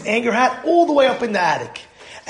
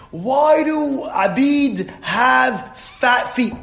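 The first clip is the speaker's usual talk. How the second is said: high, very drawn-out silly question; slow, whiny-style teasing ask; mock-earnest pestering; 5 semitones higher, much slower than usual. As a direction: stretch each word, pitched up, to needle him. Pesterer asks deliberately silly question.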